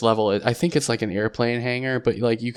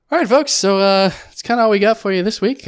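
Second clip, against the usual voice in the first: higher, louder, brisk host sign-off; podcast host outro; 8 semitones higher, 6 dB louder than usual. Acted. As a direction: wrap up the show like a radio host.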